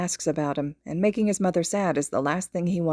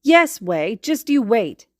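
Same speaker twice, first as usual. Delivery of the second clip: put-on loud, higher confident boy voice; cocky boast; 5 semitones higher, 7 dB louder, slower than usual. A narrator's reading. Boy cockily promises his friend. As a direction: say it with a smug grin.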